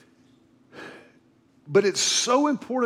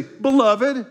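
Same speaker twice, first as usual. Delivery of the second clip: demo greeting; 5 semitones higher, 4 dB louder than usual. Shown as a demonstration. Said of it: "Turn to your neighbor and say".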